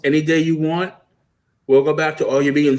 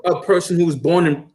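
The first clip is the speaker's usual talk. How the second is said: ordinary delivery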